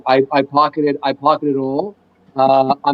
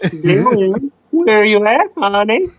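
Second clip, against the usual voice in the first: slightly higher copy of the Freddy line; 4 semitones higher, slower than usual. Shown as a demonstration. Friend repeats the Freddy line on request, lightly voiced. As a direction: repeat the teasing Freddy call. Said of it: "Do go".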